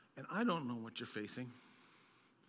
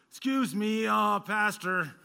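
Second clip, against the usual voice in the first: a lightly higher, louder, hesitant caller; put-on voice for effect; 8 semitones higher, 11 dB louder, slower than usual. Guest speaker calling the pastor to cancel, in a put-on hesitant voice. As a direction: drag out the polite opening, a bit higher. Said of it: "we'll go with that voice".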